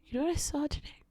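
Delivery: quiet repeat. Real, not acted.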